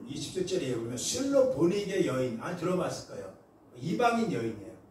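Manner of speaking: normal talking voice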